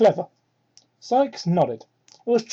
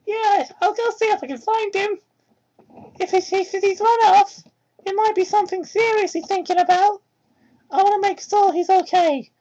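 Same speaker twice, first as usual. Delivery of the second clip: put-on much higher, childlike little boy voice; high kid voice, worried and kind; little fox kid voice; 12 semitones higher than usual. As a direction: play a sweet young kid, eager to help. Young Tails voiced high.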